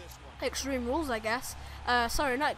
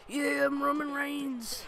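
Roman Reigns taunt; 2 semitones higher, slower than usual. Retold or imitated. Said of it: "all the guys' taunts".

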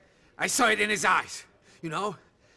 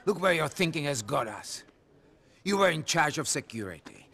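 normal talking voice

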